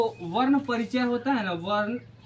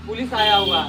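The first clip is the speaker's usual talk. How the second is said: louder, startled call that police have come; alarmed announcement; 8 dB louder than usual. Shown as a demonstration. Teacher acting a neighbour announcing police, louder and alarmed. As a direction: blurt it out as if police just showed up. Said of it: "तो कोई बोलता है police आए".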